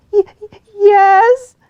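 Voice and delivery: high, loud broken sob; little fox sobbing in the tree. Acted. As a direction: stammer it through sobs. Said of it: "sobbed Figgy Two Socks".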